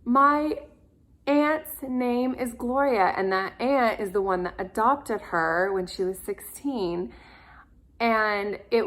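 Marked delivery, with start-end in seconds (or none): 0.1-3.1 high, slow, choked-up retelling; moved, near tears. Retold or imitated. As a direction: imitate her welling up with emotion. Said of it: "her eyes started welling up".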